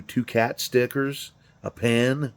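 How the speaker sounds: usual speaking voice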